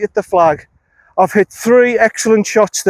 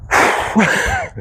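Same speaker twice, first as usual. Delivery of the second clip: breathless sigh; 3 dB quieter than usual. Real, not acted. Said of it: "sorry, I'm just catching me breath".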